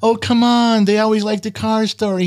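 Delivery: high-pitched mock pleading; imitating co-host. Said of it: "'cause you go".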